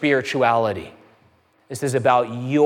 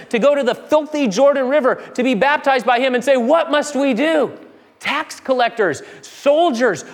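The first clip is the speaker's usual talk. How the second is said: fiery preaching; 9 semitones higher than usual. Acted. Preacher rousing the congregation, full-force preaching. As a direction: lift it to full pulpit fire.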